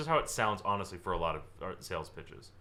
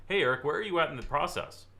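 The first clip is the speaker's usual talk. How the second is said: high, pushy salesman pitch; mock salesy probing; salesy question; 7 semitones higher, 3 dB louder than usual. Shown as a demonstration. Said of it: "What does that sound like?".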